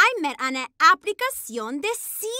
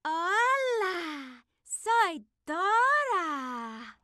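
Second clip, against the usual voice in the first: drawn-out, much softer flirty greeting; a softer, slower come-on; slow soft flirty hello; 8 dB quieter, much slower than usual. Acted. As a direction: stretch the words out softly to charm him. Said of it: "Dora, more like adorable".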